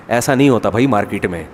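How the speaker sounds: ordinary talk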